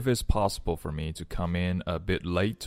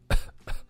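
short dry cough; coughing fit. Acted. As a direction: fake sick coughing. Acting a cough.

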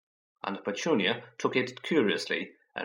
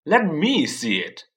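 louder, higher, slow demand; 5 semitones higher, 6 dB louder than usual. A narrator's reading. Dudley demands to see the letter, louder. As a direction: insist like a spoiled boy. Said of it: "demanded Dudley".

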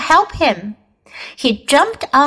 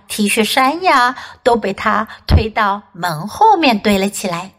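plain reading voice

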